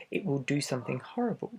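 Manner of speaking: ordinary delivery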